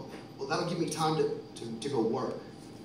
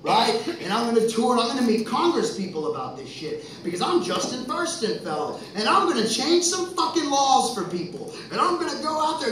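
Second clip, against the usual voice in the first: higher and louder, fast and fired up; rally yourself, building to a shout; fired-up tirade, getting louder; building fired-up rant; 9 semitones higher, 13 dB louder than usual. Real, not acted. Rising fury about calling out.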